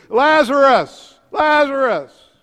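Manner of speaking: high, loud, stretched-out yell; Jesus yelling. Acted. Preacher as Jesus yells to a tomb, loudly. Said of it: "Jesus is yelling to a tomb".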